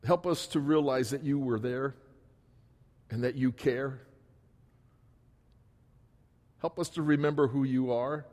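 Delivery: earnest pastoral prayer, rising and falling. Acted. Pastor praying over the congregation, earnest and heartfelt. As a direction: pray over the church with feeling. Preacher leads closing prayer.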